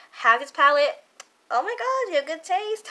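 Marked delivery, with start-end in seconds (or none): 1.5-2.9 pitched way up, gushing; excited squeal. Real, not acted. Vlogger teasing viewers who own the palette, excited squeal. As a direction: squeal it like a delighted fan.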